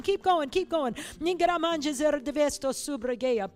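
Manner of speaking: loud, high, urgent revival push; fervent preaching. Real, not acted. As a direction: drive the crowd into tongues. Preacher leads tongues, fervent, loud.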